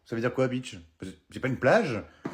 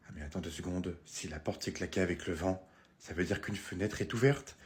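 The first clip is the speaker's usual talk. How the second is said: inquiète à voix basse; 9 semitones lower, 9 dB quieter than usual. Acted. Chuchote, inquiète.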